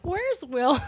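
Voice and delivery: lightly voiced high grandma; confused grandma. Forgetful grandma at dinner asking after Will, lightly imitated confusion. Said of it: "there's a grandma in this".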